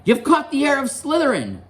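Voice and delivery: put-on very high girlish squeal; excited squeal. Girl at the Ravenclaw table calling out, high squeal. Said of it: "squealed a girl at the Ravenclaw table".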